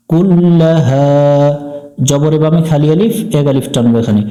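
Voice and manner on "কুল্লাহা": ordinary talk, nothing marked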